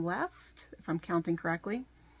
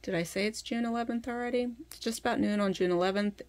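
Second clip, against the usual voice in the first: ordinary delivery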